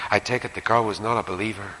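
ordinary delivery

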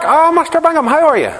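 high, eager little man greeting; wee Mark's voice. Retold or imitated. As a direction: pipe up like the wee Ballymena man, keen to chat. Wee man Mark greets preacher.

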